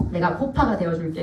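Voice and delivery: low, understated husband voice; lightly voiced man making a promise; imitated husband's offer. Husband's earnest offer, retold.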